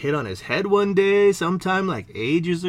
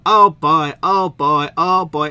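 mock oh boy; 7 dB louder, slower than usual. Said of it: "things that just go".

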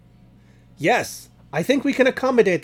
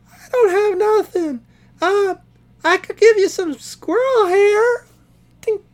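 tiny creature voice; 6 dB louder than usual. Acted. Small critter offering squirrel hair to the frogs, squeaky and high.

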